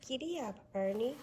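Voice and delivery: plain reading voice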